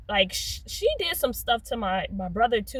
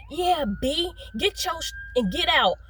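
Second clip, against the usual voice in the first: imitating yelling mom; 7 semitones higher than usual. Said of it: "she's talking to me like this".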